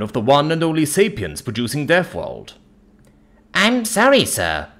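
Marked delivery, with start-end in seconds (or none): 3.5-4.7 high-pitched, grovelling apology. A narrator's reading. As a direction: apologise as a cowed shop woman. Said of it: "she grovels".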